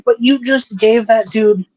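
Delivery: ordinary delivery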